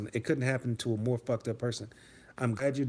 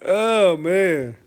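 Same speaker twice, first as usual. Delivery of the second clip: ordinary delivery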